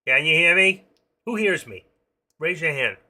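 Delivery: loud, high call to check who's listening. Pretend. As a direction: holler to an unseen audience. Checking if listeners hear, calling out.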